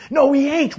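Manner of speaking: high, loud defiant denial; yelled back. A narrator's reading. Swimmer argues with the man in the boat, yelling back. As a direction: shout in protest from the water. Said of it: "Wally yelled back".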